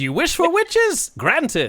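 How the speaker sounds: high, loud, drawn-out leprechaun; gleeful leprechaun. Acted. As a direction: pounce on the misheard wish theatrically. Leprechaun mock-grants witches.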